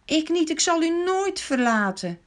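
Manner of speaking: protesting, rising on the last line; lightly voiced Peter, fervent; eager Peter protesting. A narrator's reading. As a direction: insist you'd never leave him. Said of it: "Petrus had toen gezegd".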